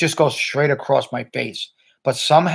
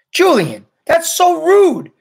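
high, loud, drawn-out rebuke; indignant scolding; 9 dB louder, slower than usual. A narrator's reading. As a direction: scold a classmate, shocked at the rudeness. Charlotte scolds Julian in the story.

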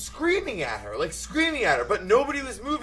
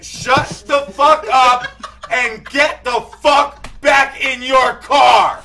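very loud, slow, raised-pitch yell; furious shouted order; 5 semitones higher, 8 dB louder, much slower than usual. Retold or imitated. Driver facing down staring man in the street, furious shout. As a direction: bellow it word by word at him.

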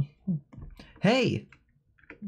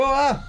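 short high louder cry; startled yelp; 10 semitones higher, 4 dB louder than usual. Real, not acted. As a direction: yelp in fright. Startled during chase.

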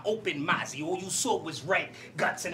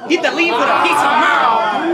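high, loud shouted bar; shouted punchline; 6 semitones higher, 15 dB louder than usual. Acted. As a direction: yell the bar out.

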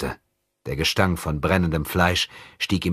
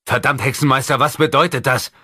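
higher, louder angry snap; barking demand; 8 semitones higher, 6 dB louder than usual. Acted. Companion demanding answers from the sorcerer, angry. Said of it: "herrschte ihn Abudun an".

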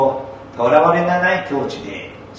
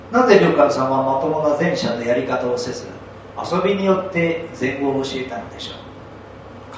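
ordinary delivery

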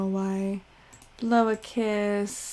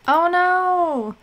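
sharp high oh no; startled yelp of alarm; high, loud surprised gasp; alarmed cry; 7 semitones higher, 6 dB louder than usual. Real, not acted. Sudden alarm at crying baby.

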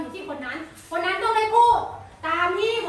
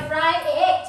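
slow model word; 4 dB louder than usual. Shown as a demonstration. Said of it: "นี่สำเนียงสุดยอดแล้วนะลูก".

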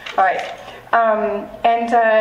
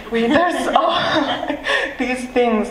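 a real laugh breaking into the words; 5 semitones higher, slower than usual. Real, not acted. Poet musing to the audience, laughing through it. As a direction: laugh through the line, amused at herself. Laughs while talking about mysteries.

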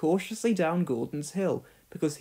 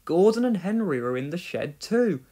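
plain reading voice

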